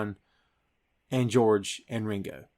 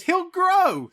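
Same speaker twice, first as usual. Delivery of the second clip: much higher, louder mock Hulk; goofy Hulk line; 4 dB louder than usual. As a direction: squeak it out as a jolly giant. Hulk's punchline quote.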